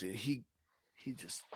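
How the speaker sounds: ordinary delivery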